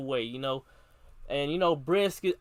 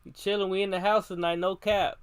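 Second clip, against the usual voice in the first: normal talking voice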